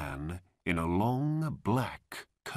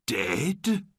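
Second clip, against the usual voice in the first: raised, disbelieving question; shocked; 5 semitones higher, 4 dB louder than usual. A narrator's reading. Old man hears of brother's death, shocked. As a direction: echo the news in dismay.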